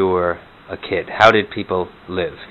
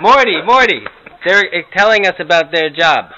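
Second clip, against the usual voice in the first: put-on high, loud, confused old grandma; 7 semitones higher, 3 dB louder than usual. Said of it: "some Morty and Herta imitations".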